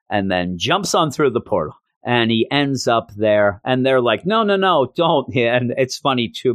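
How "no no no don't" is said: high-pitched retold protest; mock alarm. Teammates to Spider-Ham jumping through the portal, mock alarm. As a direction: imitate a group trying to stop someone. Others protest as he jumps away.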